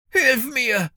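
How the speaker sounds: high, strained cry for help; gasped plea. A narrator's reading. Drowning bounty hunter to Finn, desperate gasp. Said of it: "keuchte Jenkins dunkle Gestalt".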